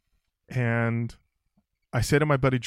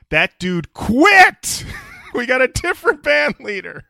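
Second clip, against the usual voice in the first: slow, loud, high shout of the payoff; big slow shouted reveal; punchline shout; 13 semitones higher, 12 dB louder, slower than usual. Real, not acted. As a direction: belt the punchline out slowly. Punchline of story.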